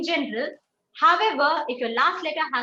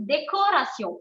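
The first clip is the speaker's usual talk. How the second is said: ordinary talk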